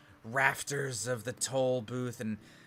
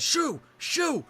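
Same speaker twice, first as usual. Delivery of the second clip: much higher, loud shout at the birds; shooing shout; 5 dB louder than usual. Acted. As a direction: chase the pests off with a yell. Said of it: "and he opens it up and he says".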